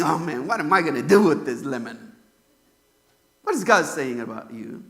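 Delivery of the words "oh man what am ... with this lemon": low, soft, mock-disappointed sigh of a line; a hint of a let-down father grumbling to himself; disappointed God. God grumbling about a disappointing believer, lower and softer. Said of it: "Or is he saying".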